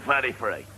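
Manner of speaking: "Thirty-three" said as firty-free; Londoner's TH as F. Acted. London job applicant giving his age, "Thirty-three" as firty-free. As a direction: swap both THs in "Thirty-three" for F. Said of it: "the Londoner has trouble with the T and the H".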